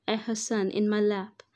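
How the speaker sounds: usual speaking voice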